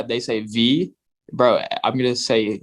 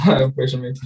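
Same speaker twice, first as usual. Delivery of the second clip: laughing. Real, not acted. Admits brushing teeth, laughing.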